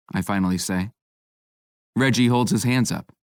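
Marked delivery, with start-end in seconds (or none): none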